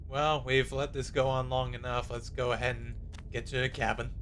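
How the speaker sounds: ordinary delivery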